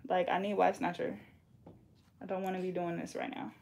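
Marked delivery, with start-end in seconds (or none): none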